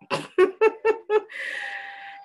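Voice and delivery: high-pitched giggle trailing off; amused high chuckle. Real, not acted. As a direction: let out a high, rippling little laugh. Amused aside after card reading.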